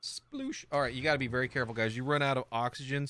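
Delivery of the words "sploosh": a higher, playful splash noise; splash sound. Pretend. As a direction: mimic diving into the water. Imitates splashing into water.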